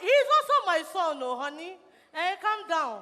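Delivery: loud pleading laugh. Acted.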